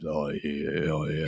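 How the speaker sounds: very low, softened smooth rumble; deep voice. Retold or imitated. Mimicking manly lesbians on a big speaker, very deep and soft. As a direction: sink right down into a mock deep speaker voice. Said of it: "very much deeper speaker".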